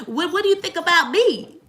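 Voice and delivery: put-on high, whiny self-centred girlfriend; needy whiny voice. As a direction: whine it like a needy partner. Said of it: "I don't think it's just about".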